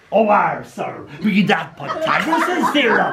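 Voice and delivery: a hint of a kid playing pirate. Year eight pupil answering in a mild pirate voice. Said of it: "speaking in a pirate O R voice".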